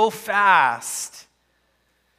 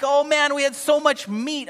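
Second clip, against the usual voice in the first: higher, mocking the nostalgic complainers; mock their fond memories of meat, pitched high; mock whining Israelites; 7 semitones higher than usual. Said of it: "They're like".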